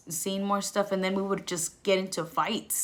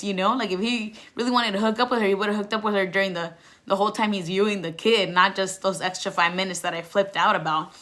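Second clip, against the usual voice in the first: usual speaking voice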